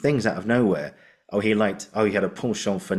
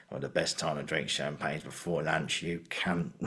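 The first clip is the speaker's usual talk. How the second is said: subtle, hushed delivery; 5 semitones lower, 10 dB quieter than usual. Retold or imitated. Actor recalling a subtle stage reading, very soft and understated. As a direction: drop almost to a murmur, understated. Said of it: "He just went".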